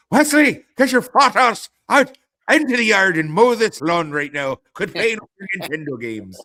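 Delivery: high, loud mock Irish scolding; Irish grandfather. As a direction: holler like an Irish grandfather ordering a child outside. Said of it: "grew up with an Irish grandfather".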